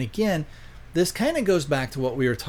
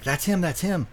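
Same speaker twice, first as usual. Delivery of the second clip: higher-pitched retold warning; imitated alarm; 6 semitones higher than usual. Woman warning the hunter her abductor is here, lightly alarmed.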